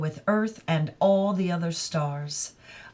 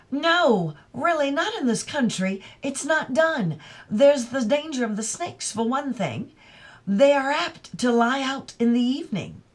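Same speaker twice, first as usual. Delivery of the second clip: put-on higher, faintly prim young man; son's lecture; 6 semitones higher than usual. Son warning mother about snakes, a touch prim. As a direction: lay down the rule to mother.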